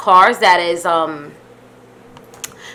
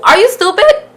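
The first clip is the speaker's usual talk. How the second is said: high-pitched, worked-up protest; indignant rant; 9 semitones higher, 4 dB louder than usual. Retold or imitated. Storyteller telling off the yelling cop, indignant. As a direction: build from incredulous questions to loud exasperation.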